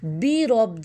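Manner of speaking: بی رب with a clear "bee" sound; correct bi vowel. Shown as a demonstration. Teacher modelling the right vowel, بی رب as "bee rob". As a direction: say بی with a pure ee, never "bay". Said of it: "بے نہیں کرنا".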